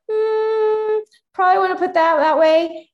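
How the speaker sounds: a hint of a gentle coach correcting; mock coach giving a note. Coach voiced giving feedback.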